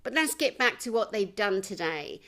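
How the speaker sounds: usual speaking voice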